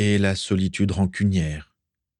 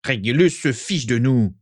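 higher-pitched, angry outburst; spat out angrily; 4 semitones higher than usual. A narrator's reading. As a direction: spit the words out with bitterness. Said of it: "éructa Rogue". (crié avec hargne)

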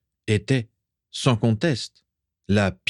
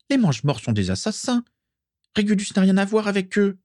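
high squeaky indignation, climbing higher; outraged protest; 12 semitones higher, faster than usual. A narrator's reading. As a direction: insist in an offended high girlish voice. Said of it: "s'insurgea-t-elle". (indignation aiguë qui monte)

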